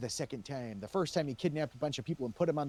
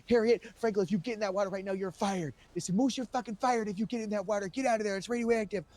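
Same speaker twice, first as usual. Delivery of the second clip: retold shout; 8 semitones higher, 4 dB louder than usual.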